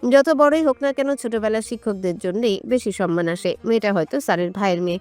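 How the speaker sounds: plain reading voice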